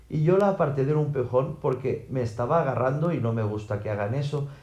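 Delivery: ordinary delivery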